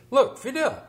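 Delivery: lightly voiced Boston accent, high call; a hint of a Boston Kennedy. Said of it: "he'd do it in a Boston accent".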